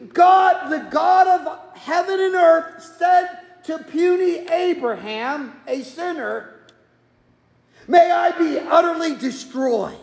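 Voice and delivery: fervent pulpit shout; preaching at full force. Acted. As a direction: proclaim God's promise to the back row. Preaching God's self-curse.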